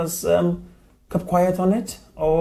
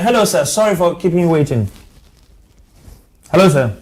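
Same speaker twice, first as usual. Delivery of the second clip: raised, higher phone-greeting voice; calling to caller; 5 semitones higher, 5 dB louder, faster than usual. Real, not acted. Host greets a waiting caller on air, calling out. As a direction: project a loud hello down the line.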